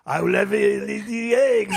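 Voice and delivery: high, hammed-up French accent; halting English in a mock French accent. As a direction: imitate a tourist faking French with an accent.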